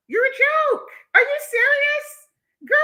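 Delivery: high, loud disbelieving shriek; incredulous shriek. Real, not acted. Streamer shrieking at a troll in disbelief. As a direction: squeal it in outraged disbelief.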